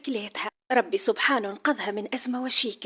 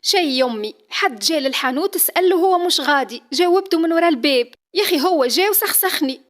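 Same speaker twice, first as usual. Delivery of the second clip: sulky complaint; 6 semitones higher, 8 dB louder than usual. Acted. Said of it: "معوجة شفتها تتأفف".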